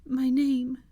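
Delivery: high, anxious nudge; prompting. A narrator's reading. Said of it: "she prompted".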